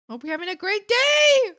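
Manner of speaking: high, loud, gleeful wish; excited well-wishing. Real, not acted. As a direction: belt it out cheerily, high and loud. Cheering a viewer's event.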